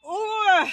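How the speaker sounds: loud, high-pitched "ooh"; heated, loud groan of recognition; exasperated "ooh". Real, not acted. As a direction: let out a big, triggered "ooh". Reacting to a triggering example.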